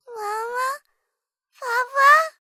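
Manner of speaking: put-on high, loud little child calling; small child. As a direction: call out like a scared little girl.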